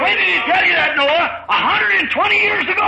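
high-pitched incredulous taunt; scoffing challenge. Acted. Scoffer challenges Noah's promise.

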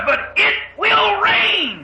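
high, slow, drawn-out shout; thundering conviction. Acted. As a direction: override all doubt with a ringing cry. Noah overrides the complaints.

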